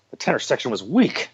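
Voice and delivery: ordinary delivery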